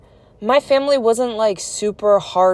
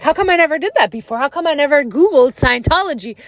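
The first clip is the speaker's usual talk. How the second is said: quick, higher, incredulous retelling of the dad; amazed realization; 7 semitones higher, 4 dB louder, faster than usual. Retold or imitated. Leader's dad waking up, asking why he never googled, amazed. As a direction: rattle it off, stunned you never thought of it. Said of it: "he's like".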